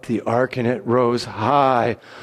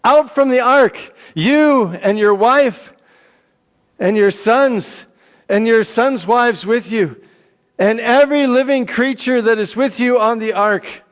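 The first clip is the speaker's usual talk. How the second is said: loud, high call; God ordering Noah out of the ark, booming; booming command; 10 semitones higher, 8 dB louder than usual. Acted. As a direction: call it out with full force.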